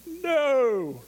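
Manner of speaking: high retold cry of dismay; imitating his own shocked "no!" at the car door; mock horror. Sees teens dent his truck.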